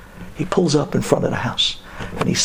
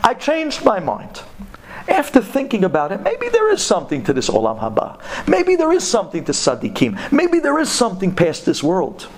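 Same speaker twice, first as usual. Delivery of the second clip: pleading taxi driver; 6 semitones higher than usual. Acted. Taxi driver asking the kollel guy to return the paper, higher coaxing voice. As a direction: talk him into giving it back.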